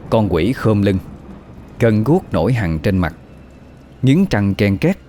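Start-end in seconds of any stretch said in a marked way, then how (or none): none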